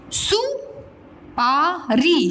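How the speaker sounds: सुपारी drawn out; very slow. Shown as a demonstration. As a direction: stretch सुपारी for the class. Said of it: "teacher models word slowly".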